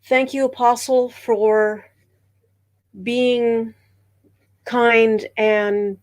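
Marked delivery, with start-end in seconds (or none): none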